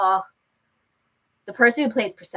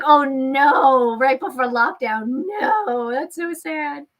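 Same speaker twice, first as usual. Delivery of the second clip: drawn-out, higher-pitched sympathy; sympathetic dismay; 4 semitones higher, slower than usual. Real, not acted. Streamer commiserating with viewer over lockdown, dismayed. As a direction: lament it for them.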